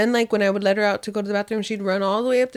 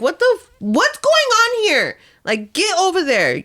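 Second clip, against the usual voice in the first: high-pitched acted exasperation; exasperated scolding; 9 semitones higher than usual. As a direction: scold a runaway dog, drawn out at first. Scolding the runaway dog, acted.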